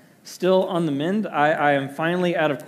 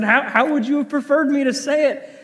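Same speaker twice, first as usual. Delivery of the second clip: higher and louder, a hint of a baffled husband; puzzled husband; 11 semitones higher, 6 dB louder than usual. Husband asking his upset wife how to say it, baffled. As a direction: ask it like a confused husband.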